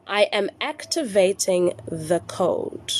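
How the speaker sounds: slow, deliberate dictation of a phrase; really slow. Shown as a demonstration. Coach dictating the phrase to followers, really slowly. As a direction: say it nice and slow, word by word. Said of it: "I'm gonna say it really slowly".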